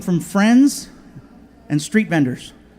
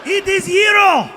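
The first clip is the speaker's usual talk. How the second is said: put-on much higher, insistent Greek accent; 10 semitones higher, 4 dB louder than usual. Vendor insists.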